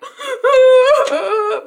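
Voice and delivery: high, loud mock wail; exaggerated crying. Viewer reenacting crying while watching, loud high wail. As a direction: bawl in a hammed-up sob. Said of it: "I was like".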